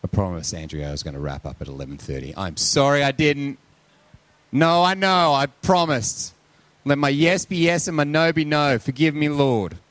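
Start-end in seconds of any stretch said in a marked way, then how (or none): 2.4-9.8 mock contrition. Real, not acted. Apologising for running over time.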